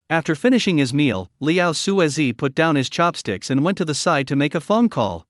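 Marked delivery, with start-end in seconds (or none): none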